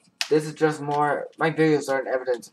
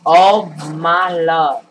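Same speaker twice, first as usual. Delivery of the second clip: drawn-out loud exclaim; 2 semitones higher, 11 dB louder, much slower than usual. Real, not acted. Excited in-game reaction.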